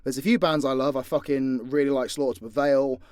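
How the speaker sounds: ordinary talk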